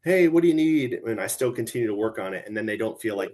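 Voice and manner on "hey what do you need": higher, louder, brisk "Hey, what do you need?"; busy boss barking a greeting; distracted loud greeting. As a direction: call out without looking up. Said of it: "as opposed to them coming in".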